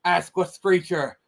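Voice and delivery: hammed-up Kennedy accent, orating, breaking into laughs; JFK impression. Reading the JFK speech to a stream buddy in a hammed-up Kennedy accent. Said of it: "in my best J F K accent".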